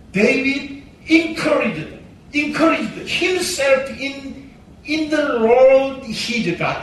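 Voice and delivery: each English word read out very slowly; slow verse reading. Shown as a demonstration. Preacher reading the English verse to the church, very slowly.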